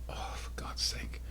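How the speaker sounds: whispered exasperation at the cat; fed-up whisper aside; exasperated whisper. Real, not acted. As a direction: breathe it out in frustration.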